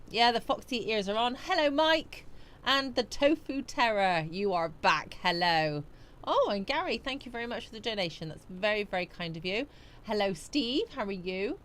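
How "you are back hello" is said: normal talking voice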